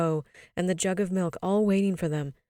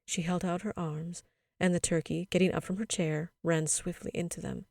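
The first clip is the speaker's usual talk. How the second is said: ordinary delivery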